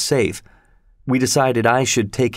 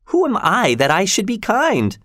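high, sharp raised voice; 10 semitones higher than usual. Acted. Miss Havisham snapping back at Pip, raised voice. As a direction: fling the question back, much higher. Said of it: "raising her voice".

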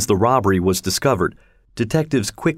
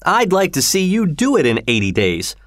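raised, higher challenge; indignant higher cry; 8 semitones higher than usual. Acted. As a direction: throw down the dare, voice up high. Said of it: "cried Mister Stewart".

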